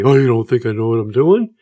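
indignant nurse, lightly imitated; huffy retort. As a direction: mimic her getting all huffy. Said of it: "She got all huffy".

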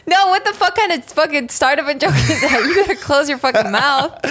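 laughing through a playful scolding; laughing mock outrage. Real, not acted. Teasing her husband over his joke about wives, laughing mock outrage. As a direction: tease him back with a laugh, fake offended.

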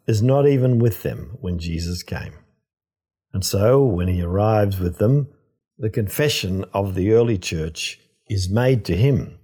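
usual speaking voice

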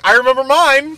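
mock her friend's proud answer; imitating her cohost. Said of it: "and she's like".

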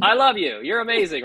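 high, amused mock gush. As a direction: exclaim it with playful adoration. Acts out his delighted reply to colleague.

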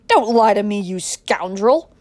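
loud, explosive accusation; enraged father's outburst, drawn out on the insult; furious shouting. A narrator's reading. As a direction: shout as if losing all control. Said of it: "shouted Adam".

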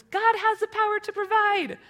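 loud, very high cartoon duck shout; small boy's duck-voice declaration; Looney Tunes duck. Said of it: "talking like the duck from Looney Tunes".

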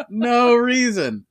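milk the innocent denial; hammed-up no reason. Host repeats his denial after Jesus talk, hammed up and slow.

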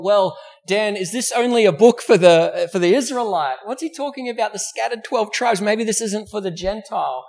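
a hint of a skeptical listener asking the preacher; mock doubter. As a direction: play a confused churchgoer raising an objection. Voicing doubtful listeners' question.

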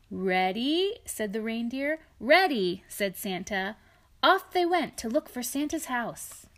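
storybook read-aloud, lightly lilting; reading to children. A narrator's reading. Parent reading a picture book to a child, gentle storytelling. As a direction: read it to a little one beside you.

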